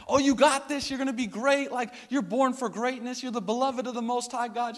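high, eager cheering on; encouraging. Retold or imitated. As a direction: coax her bravely from below. Encouraging girl on the platform.

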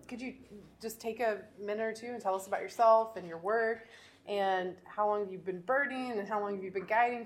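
ordinary talk, nothing marked

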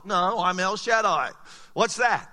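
usual speaking voice

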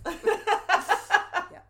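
a laughing high yeah. Real, not acted. Laughing at the joke.

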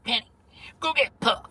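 a lightly high cartoon grandma; high, half-laughing cartoon grandma line. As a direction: call it like a bossy cartoon granny. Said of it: "And she's like".

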